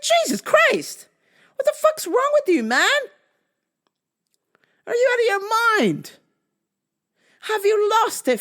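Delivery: high-pitched, incredulous outburst; outraged yelling. Retold or imitated. Walker to driver who tried to run him over, outraged yell. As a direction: yell in disbelief at a reckless driver.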